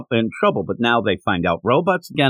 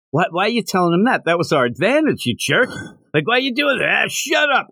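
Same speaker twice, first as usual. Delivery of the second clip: higher-pitched mild mock outrage, rising to a squawk; mock scolding; 7 semitones higher than usual. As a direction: imitate someone telling off a blabbing friend. Acted scolding of a blabbing friend.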